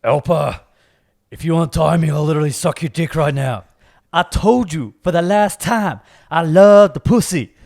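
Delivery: loud, insistent movie-character begging; hammed-up movie plea. Friend acting out a film line to be guessed, hammed-up plea. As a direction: act it out big and loud.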